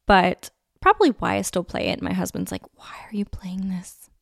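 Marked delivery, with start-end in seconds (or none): 2.8-4.1 hushed whisper mimicking a husband; soft, voiceless mock complaint; whispered imitation. Imitates husband questioning her music.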